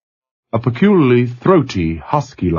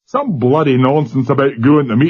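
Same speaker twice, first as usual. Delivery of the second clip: put-on Glasgow accent; 5 semitones higher than usual. A narrator's reading. Glasgow seaman.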